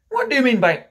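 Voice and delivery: loud, high indignant demand. Acted. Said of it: "Mister Darling demanded".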